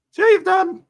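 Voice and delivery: very high, loud squeal of exasperation; squealed mock complaint. Real, not acted. Streamer blaming chat for the confusion, high exasperated squeal.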